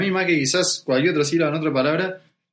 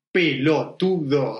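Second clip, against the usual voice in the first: "pelotudo" very slow, syllable by syllable; smooth staircase; 3 semitones lower, much slower than usual. Shown as a demonstration. Savouring local slang for a foreign friend, smooth slow staircase "pelotudo". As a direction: step gently through pe-lo-tu-do. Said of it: "es como una escalera, ¿viste? Suave, así".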